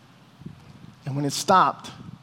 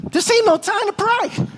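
panicked plea; 12 semitones higher than usual. Retold or imitated. Panicking in a prison fight.

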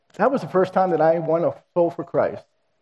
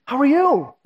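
put-on high, bright greeting of an old friend; light up at the reunion; meeting Elaine after twenty years, excited; delighted surprise; 10 semitones higher, 3 dB louder, slower than usual.

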